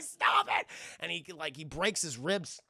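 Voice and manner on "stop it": high, loud mock protest; pained shout. Burglar hit by his partner's crowbar, pained shout. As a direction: cry out as if being clobbered.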